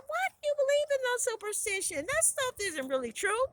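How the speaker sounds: high-pitched amused disbelief; incredulous. A narrator's reading. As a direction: chide her for believing such things. Relatives scoff at her superstition.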